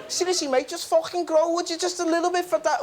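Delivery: high, exasperated mock Scouse; mock Scouse accent. Gardener urging a stubborn plant to grow, exasperated mock Scouse. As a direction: plead impatiently in a high-pitched Scouse accent. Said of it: "Poor Scousers".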